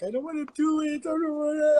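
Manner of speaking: high, wailing mock sob of a cook who wants to quit; pleading high wail, imitating a crying cook; whiny crying cook. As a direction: whine it like a broken line cook in tears.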